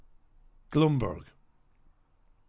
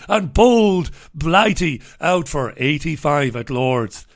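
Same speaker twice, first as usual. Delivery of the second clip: mock commentator boast; 3 semitones higher, 9 dB louder, slower than usual. Gleeful mock commentary on score.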